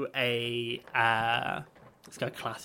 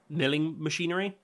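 ordinary talk, nothing marked

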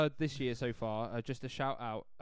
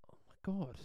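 breathless whisper; 3 semitones higher, 8 dB quieter than usual. Real, not acted. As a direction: whisper it in awe. Stunned at the quiz clue.

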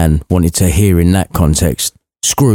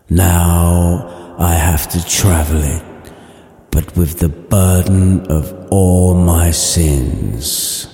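spooky ghost voice; 2 semitones lower, much slower than usual. Acted. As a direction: drag every word out like a chained ghost. Marley's ghost explains.

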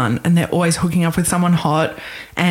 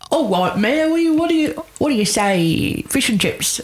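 put-on a deliberately clumsy American stab at an Australian accent; mock Australian accent, overdone and halting, jumping high in the middle; bad Australian accent; 8 semitones higher than usual. Podcast host mocking Americans doing Australian, in a bad Australian accent. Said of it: "Americans doing Australian".

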